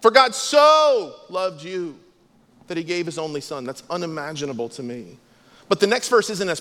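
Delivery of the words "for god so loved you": "so" pressed, louder and slow; stress on SO. Shown as a demonstration. Pastor applying the verse to listeners, "so" stressed. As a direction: linger on "so" in "For God so loved you". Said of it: "It's the word "so".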